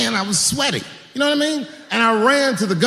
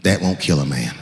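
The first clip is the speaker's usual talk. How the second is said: deep clerk voice; 14 semitones lower than usual. Retold or imitated. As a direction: drop low like a deadpan gun clerk. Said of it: "he goes just like this".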